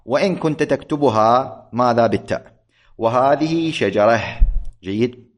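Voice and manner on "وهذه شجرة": "شجرة" ending in h, shajarah; slow demo of "شجرة" with final ha; pausal ha ending. Shown as a demonstration. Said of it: "يعني، تلفظها".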